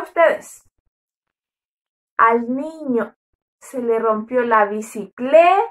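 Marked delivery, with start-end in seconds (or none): none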